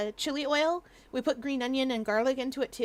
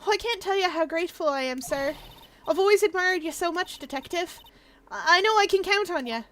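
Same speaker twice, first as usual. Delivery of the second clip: lightly voiced grateful defendant, somewhat raised; Maggie, grateful admirer; 6 semitones higher, 4 dB louder than usual. Maggie thanking the detective, mildly gushing gratitude. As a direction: gush thanks lightly in character.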